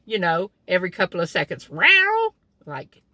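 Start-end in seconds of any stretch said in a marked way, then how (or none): 1.7-2.3 much higher meowing like a demanding cat; imitating a cat asking for cream. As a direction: meow up high, rising at the end. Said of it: "It first started out as a meow".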